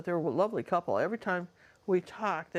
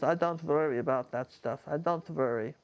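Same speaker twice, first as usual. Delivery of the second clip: imitated Bulgarian accent, W turned to V; Eva's Bulgarian accent; 2 semitones lower than usual. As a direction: retell it in a friend's Bulgarian accent. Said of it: "I loved her accent".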